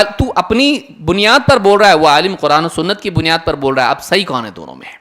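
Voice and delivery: normal talking voice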